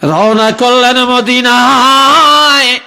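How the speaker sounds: high, loud, drawn-out preacher's cry; impassioned proclamation. Real, not acted. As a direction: proclaim it with a long held last word. Preacher cries out story's turning point. (উঁচু স্বরে টেনে ওয়াজের ঘোষণা)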